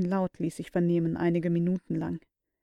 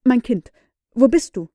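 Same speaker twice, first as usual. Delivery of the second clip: anxious call; 3 semitones higher, 8 dB louder than usual. Acted. Uncle calls anxiously.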